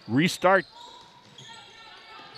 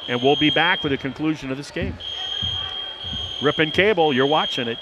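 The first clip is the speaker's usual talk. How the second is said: shouting over fire alarm; 2 semitones higher, 4 dB louder than usual. Real, not acted. Fire alarm ringing in gym.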